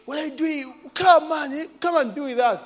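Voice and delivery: high coaxing invitation; lightly voiced friend urging; a friend's coaxing invite. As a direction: coax him to join. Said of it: "he's like".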